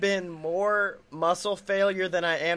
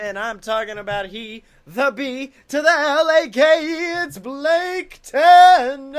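rising to booming shout, slowing at the end; building announcer shout; 8 semitones higher, 12 dB louder than usual. Pretend. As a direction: call the name out like a ring announcer. Announcer introduces cohost.

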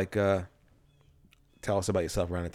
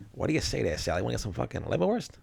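put-on old-timey voice; 6 semitones higher than usual. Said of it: "Like that old-timey voice".

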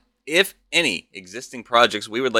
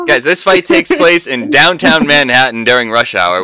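announcer; 2 semitones higher, 9 dB louder than usual. Acted. Announcing fight setting.

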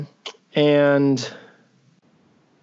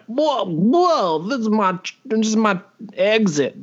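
much higher, hurried panicked Xander; Xander's voice; 9 semitones higher than usual. Retold or imitated. Xander fleeing the scene, high panicky impression. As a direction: squeak out a frantic excuse to leave. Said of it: "It's his voice".

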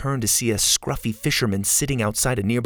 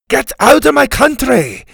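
very high, loud outrage; indignant yell; angry shout; 13 semitones higher, 8 dB louder than usual. A narrator's reading. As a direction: holler down at the enemy below. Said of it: "was now shouting down at the crew".

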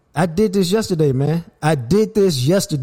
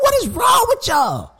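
outraged shout. Retold or imitated. Shopper yelling at the store over water prices, outraged.

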